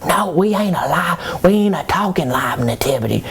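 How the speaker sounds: high, breathy whisper of fake surprise; mock surprised whisper. Joseph pretending to be shocked at Mary's scolding, whispered. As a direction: play dumb in a hushed gasp. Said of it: "And so I act surprised".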